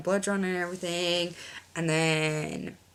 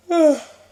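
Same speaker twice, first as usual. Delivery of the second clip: long heavy loud sigh; 11 semitones higher, 15 dB louder than usual. Real, not acted. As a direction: let out a big sigh. Tired sigh in story.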